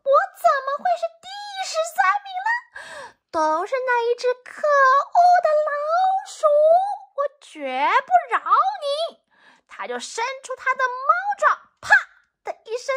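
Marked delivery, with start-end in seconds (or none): 0.0-9.2 very high, fast angry cat screech; furious cat. Acted. Cat vowing revenge on the rat, high furious screech. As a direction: rage at the rat who tricked you. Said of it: "猫咪说".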